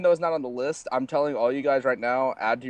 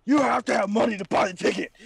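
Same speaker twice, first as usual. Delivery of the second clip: high-pitched excited shout; overexcited outburst; 8 semitones higher than usual. Retold or imitated. Friend re-enacting how he scared off coffee shop customers, excited shouting. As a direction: yell it like an overexcited moviegoer. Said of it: "getting a little bit excited, going".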